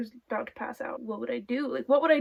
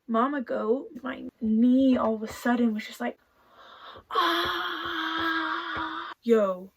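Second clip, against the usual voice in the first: normal talking voice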